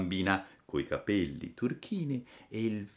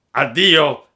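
loud, raised villain voice; sneering, jeering farewell; 5 semitones higher, 12 dB louder than usual. A narrator's reading. Bored assassins leave hanged puppet, loud jeering farewell. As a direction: call out a mocking goodbye. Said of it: "gli dissero sghignazzando".

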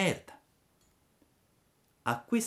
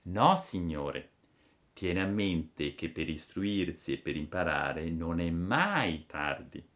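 firm fairy. Acted. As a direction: lay it down slowly and firmly. (fata severa, lenta e bassa)

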